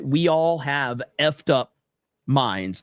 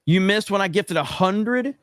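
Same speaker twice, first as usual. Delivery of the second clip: ordinary talk, nothing marked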